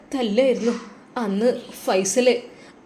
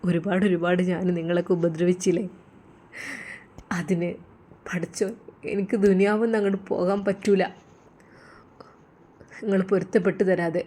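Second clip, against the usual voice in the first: low, soft, fading sorrow; the crying father asking to be forgiven; tearful confession; 4 semitones lower, 7 dB quieter than usual. A narrator's reading. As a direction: confess quietly, close to tears. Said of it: "നിങ്ങൾ കരയണത്".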